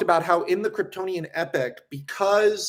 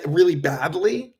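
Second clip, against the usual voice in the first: ordinary talk, nothing marked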